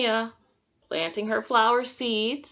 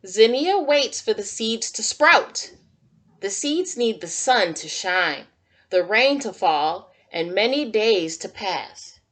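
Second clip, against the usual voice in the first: put-on reading to children; 5 dB louder than usual. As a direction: read it like storytime for little kids. Reads picture book to children.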